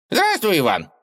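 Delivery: tiny high squeaky gopher. A narrator's reading. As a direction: squeak it out like a rodent. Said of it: "писклявым голосом произнёс волк-суслик".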